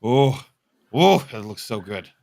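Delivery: ordinary delivery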